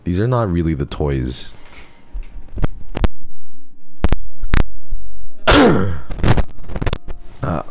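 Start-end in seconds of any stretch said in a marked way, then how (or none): 1.6-7.4 long harsh hacking cough; loud coughing fit. Real, not acted. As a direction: cough hard and can't stop. Coughing fit mid-stream.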